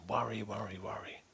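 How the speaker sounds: mock inner worrying, very soft; whispered worry. Coach imitating a sneaky quiet inner voice, hushed. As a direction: murmur it like a nagging inner voice. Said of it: "inside their head going".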